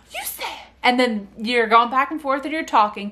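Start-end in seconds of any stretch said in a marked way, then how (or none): 0.1-0.7 high, soft gasping wonder; delighted baby talk. Shown as a demonstration. Parent acts amazed the baby spoke, delighted. Answering baby's babble.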